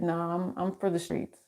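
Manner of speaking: soft, understated serious quote; dead serious. Retold or imitated. Man insisting after a shrug, imitated as softly serious. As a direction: quietly imitate his earnest insistence. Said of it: "he was so serious".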